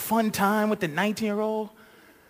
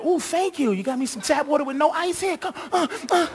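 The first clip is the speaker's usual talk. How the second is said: flirty woman; 6 semitones higher than usual. Retold or imitated. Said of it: "Just for giving me tap water".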